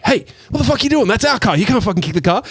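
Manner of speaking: high, fast mock outrage; angry brothers. Brothers yelling at Buscemi for kicking their car, high mock outrage. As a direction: squeal it like the offended brothers. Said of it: "all the brothers are just like".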